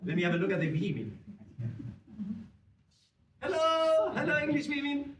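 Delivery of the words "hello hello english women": high sing-out greeting; calling out. Real, not acted. Performer greeting the English women in the audience, calling out. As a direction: call across the theatre in a raised pitch.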